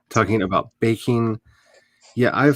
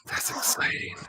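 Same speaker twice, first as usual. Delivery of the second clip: soft excited whisper; 10 dB quieter than usual. Real, not acted. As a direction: breathe it out as a secret. Hushed aside while chatting.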